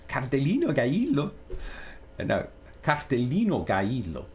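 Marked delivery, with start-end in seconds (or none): 0.1-1.3 quick, swooping mock Mexican accent. Streamer giving in to the irresistible Mexican accent. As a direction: relish the put-on Mexican accent. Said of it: "it's irresistible".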